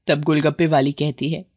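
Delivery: ordinary delivery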